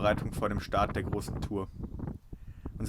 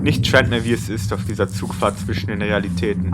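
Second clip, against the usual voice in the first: poetic narration; 11 dB louder than usual. Acted. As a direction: read it like a travel poem.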